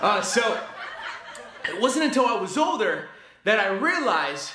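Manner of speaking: put-on stand-up voice, laughing at his own joke; milk the crowd between chuckles; Carlos Mencia impression. Reviewer parodies a comic working the audience, hammed-up stand-up delivery. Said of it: "my best attempt at Mencia".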